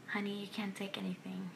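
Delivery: soft warning. Retold or imitated. Sister's gentle warning retold.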